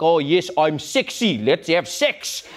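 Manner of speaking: slow, low mock South African accent. Comedian parodying a traditional South African seduction, South African accent. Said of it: "the traditional South African accent".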